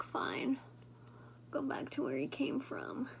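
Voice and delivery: a hushed retelling, all whispered. Retold or imitated. Telling her viewers the Shrek plot, whispering. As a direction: drop to a secret whisper.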